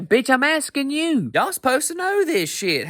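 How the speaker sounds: high, slow, incredulous snap back at the phone; drawn-out disbelieving comeback; exasperated retort. Acted. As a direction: talk back to the app like it's stupid. Yelling back at weather app.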